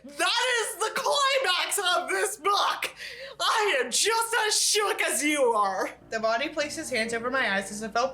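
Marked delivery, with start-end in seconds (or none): 0.0-5.9 excited shout. Real, not acted. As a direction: announce it like a hammed-up game show host. Reader hyping the book's climax.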